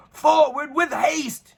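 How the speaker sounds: high, slow battle cry; shouted charge. A narrator's reading. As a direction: lead the charge at the dragon. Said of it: "shouted Uniqua the knight".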